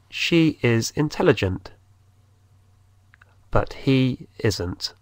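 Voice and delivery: slowly, he stressed; slow dictation pace; slow model. Shown as a demonstration. Teacher repeats slowly for learners. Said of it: "pero él no es".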